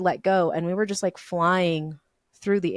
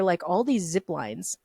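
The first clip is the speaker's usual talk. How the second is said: normal talking voice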